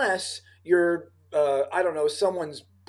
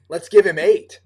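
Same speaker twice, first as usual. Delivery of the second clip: the repeat said much louder, punching give; emphatic producer quote; 11 dB louder than usual. Retold or imitated. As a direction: belt it out like the eager producer.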